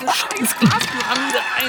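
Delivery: high, loud and slow desperate yell; shouting. Acted. Shouting at the glass pyramid in panic. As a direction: scream it out in panic.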